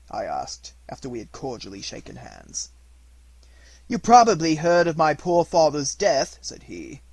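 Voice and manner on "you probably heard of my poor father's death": a hint of grave young aristocrat, much louder; lightly voiced Musgrave telling sad news, pushed loud. A narrator's reading.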